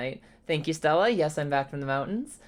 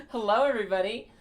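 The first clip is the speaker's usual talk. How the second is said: ordinary delivery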